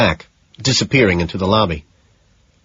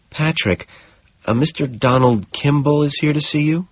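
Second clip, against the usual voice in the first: higher, hesitant secretary voice; nervous; 6 semitones higher than usual. A narrator's reading. Jean nervously announcing a visitor to Patrick, higher voice. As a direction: announce it with a touch of nerves. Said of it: "she says nervously".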